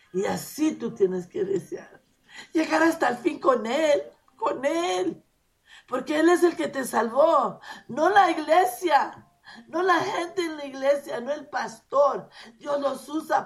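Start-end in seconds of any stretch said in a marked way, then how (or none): 2.5-13.5 higher and louder, pressing each phrase; fervent preaching. Real, not acted. Preacher urging the congregation to hold on, fervent. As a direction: preach it with full conviction.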